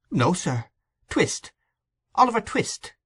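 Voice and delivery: put-on high, small boy's voice; young boy correcting. A narrator's reading. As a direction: correct him politely in a high child's voice. Timid boy correcting the gentleman.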